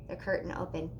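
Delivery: ordinary delivery, nothing marked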